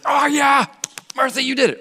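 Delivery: high, loud cheering shout; excited cheer. Retold or imitated. Preacher cheers Martha for finally getting it, loud high shout. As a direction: celebrate her like a fan, high and loud.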